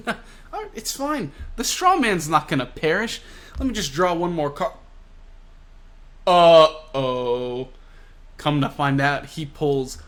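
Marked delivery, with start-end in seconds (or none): 0.1-4.7 high-pitched mock reassurance with a laugh; nervous dismissal. Hawkins drawing one more card, mock nervous dismissal. Hawkins brushes off his trouble. 6.3-7.7 loud, drawn-out uh-oh; mock dread. Narrator as Hawkins draws a card, mock dread.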